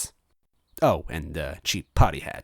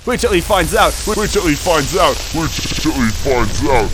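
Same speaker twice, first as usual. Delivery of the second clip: high, loud looping, slowing at the end; echoing robot loop; 9 semitones higher, 10 dB louder than usual. Acted. Robot voice from the furnace, looping. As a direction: echo the loop like a broken record.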